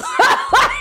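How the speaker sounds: sudden high cackle of laughter; high burst of laughter. Real, not acted. Laughing at her own story to viewers, high cackle. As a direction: laugh out loud, high and bright.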